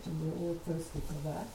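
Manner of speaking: usual speaking voice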